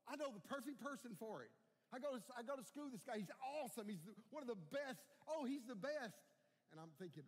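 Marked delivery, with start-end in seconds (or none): 0.1-6.2 higher, louder gushing recommendation; retold eager enthusiasm. Stranger recommending his schoolmate as worship leader, eager. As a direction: act out someone eagerly vouching for a friend.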